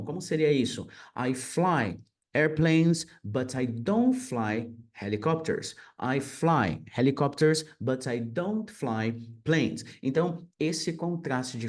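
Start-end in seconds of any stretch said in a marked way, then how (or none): 1.2-9.8 slow model sentences. Shown as a demonstration. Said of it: "teacher model sentences, slow".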